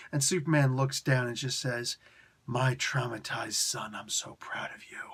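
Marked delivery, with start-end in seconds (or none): 2.5-5.0 fake-sentimental whisper to the son; mock tender whisper. Voicing Superman mockingly.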